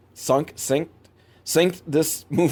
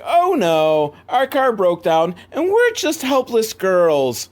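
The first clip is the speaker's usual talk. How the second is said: mock helpless damsel; 8 semitones higher, slower than usual. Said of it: "they're pretending like".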